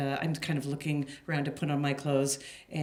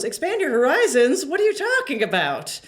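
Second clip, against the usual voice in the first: high, loud puzzled question; baffled, as if hearing nonsense; confused friend; 9 semitones higher, 6 dB louder than usual. Retold or imitated. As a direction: friend baffled by the code phrase.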